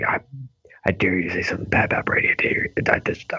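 fast, breath-only mock menace; whispered mock threat. Host mimicking Boston fans defending Brady, whispered mock threat. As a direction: whisper it like a touchy fan daring you.

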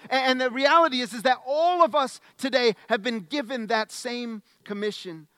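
angel's charge voiced with fervor; preaching. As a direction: urge them out the door. Rising preaching.

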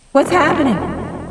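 a high haunted What's happening; high eerie echo. Acted. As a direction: ring it out like a ghost. Repeated in a different voice.

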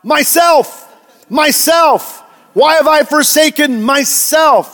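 high, loud parody of a cry; mock crying out. Preacher parodies a modalist Jesus, mock crying out. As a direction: call it out as an absurd mock lament. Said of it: "he should have called out".

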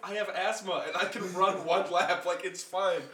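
high, loud, slow mocking voice; mock-exasperated reassurance, loud and high; mock dismissive self. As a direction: brush them off in a high sing-song. Mocking whiny band kids.

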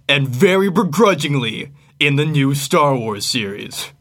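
booming movie trailer announcer. Acted. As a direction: sell it like a summer blockbuster trailer. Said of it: "do their best trailer voice".

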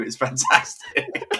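squeaked out high through laughter; can barely get it out for laughing; slow, laugh-broken and very high. Real, not acted.

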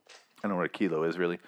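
ordinary talk, nothing marked

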